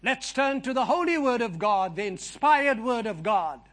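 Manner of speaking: ordinary delivery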